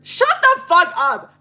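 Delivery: high, loud, drawn-out outburst; loud fed-up cry; exasperated yell. Real, not acted. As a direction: shout it in disbelief, leaning on each word. Exasperated at his boast.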